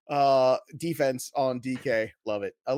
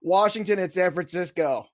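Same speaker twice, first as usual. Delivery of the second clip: normal talking voice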